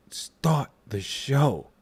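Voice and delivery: slow whispered command; dramatic whisper. Pretend. Vlogger cueing his own show, slow dramatic whisper. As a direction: whisper it slowly, like opening a stage show.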